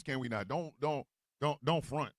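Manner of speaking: ordinary delivery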